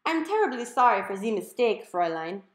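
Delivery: high, loud, hammed-up German accent; an overeager German butler voice; German computer squeal. A narrator's reading. Home computer answering Mom, squealing in a German accent. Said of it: "high-pitched German accent".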